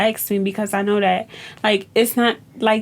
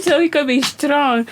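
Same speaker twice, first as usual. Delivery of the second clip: put-on slow, higher kid voice on "strong"; proud little student; 4 semitones higher than usual. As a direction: imitate a small child's drawn-out, proud answer. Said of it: "I said".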